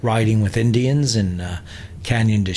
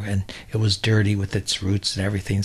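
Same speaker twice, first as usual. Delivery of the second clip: usual speaking voice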